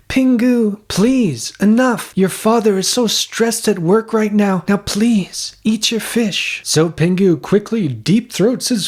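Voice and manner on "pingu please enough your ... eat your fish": high fretful mother pleading; mom voice. Acted. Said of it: "And Mom is like".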